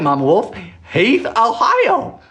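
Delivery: usual speaking voice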